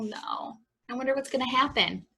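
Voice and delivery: ordinary delivery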